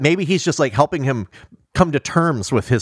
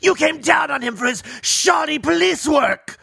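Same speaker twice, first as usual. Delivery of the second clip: accusing shout; 13 semitones higher than usual. Retold or imitated. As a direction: hurl the blame at him. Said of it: "he's really like".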